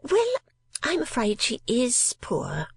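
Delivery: high, affected spinster voice; mincing lady voice. A narrator's reading. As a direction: play a fussy miniature painter. Miss La Creevy speaks.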